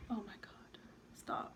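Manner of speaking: breathless awed whisper. Real, not acted. To her viewers, gazing at her highlight, whispering. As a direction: whisper it, overwhelmed by the glow.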